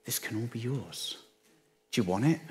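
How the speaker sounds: softly offered temptation, slightly hushed; a lightly voiced Satan offering the kingdoms; hint of sly Satan. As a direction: tempt him gently. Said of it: "Satan comes to him and says".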